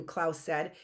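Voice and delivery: plain reading voice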